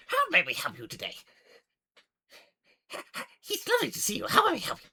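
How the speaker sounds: high, excited gnome shopkeeper; eccentric gnome. Acted. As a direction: greet a customer in a squeaky gnome voice. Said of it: "They go".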